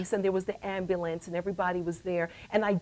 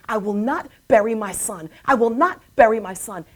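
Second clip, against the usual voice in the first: retold firm refusal, building louder on the repeat; defiant vow; 7 dB louder than usual. Mother answering the voice that threatened her son, defiant. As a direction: declare it with mounting force.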